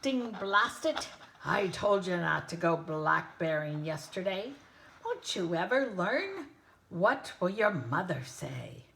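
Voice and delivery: loud, angry scolding roar; angry roaring lion. Acted. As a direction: roar it out at yourself in a temper. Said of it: "heard an angry animal roaring".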